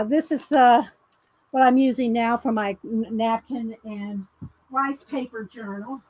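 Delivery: normal talking voice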